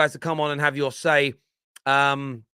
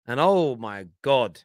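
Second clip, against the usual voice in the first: ordinary delivery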